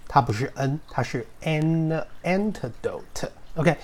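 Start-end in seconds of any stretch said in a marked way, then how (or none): 1.4-3.3 an antidote said slowly and softly with a clear n; slow correct an. Shown as a demonstration. Teacher modelling the right n in antidote. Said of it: "不是n，它不是n".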